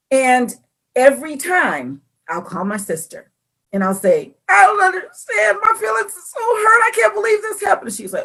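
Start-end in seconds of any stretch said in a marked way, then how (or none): 4.5-7.9 high, hammed-up tearful complaint; squeaky poor-me voice; mock whining. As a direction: wail to your sister in mock hurt.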